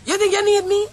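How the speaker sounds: usual speaking voice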